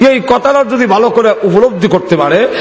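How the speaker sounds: high, insistent sermon voice; preaching. Acted.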